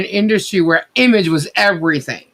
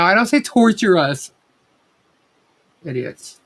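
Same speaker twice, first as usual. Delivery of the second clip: ordinary delivery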